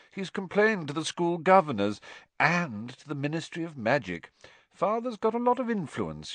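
high-pitched affected gloating; affected boasting. A narrator's reading. Malfoy boasting of his father's influence, affectedly.

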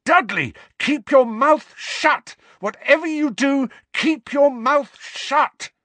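high, loud, emphatic yell; frantic yelled order; desperate warning shout. A narrator's reading. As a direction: shout each word urgently across the dark.

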